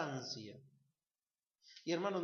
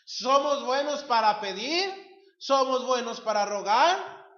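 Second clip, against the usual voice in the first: louder, higher, building on pedir and rogar; rising preacher emphasis; 6 semitones higher, 12 dB louder than usual. Acted. Preacher chiding the congregation, louder and higher. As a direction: push both lines up, almost shouting. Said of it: "preacher building emphasis".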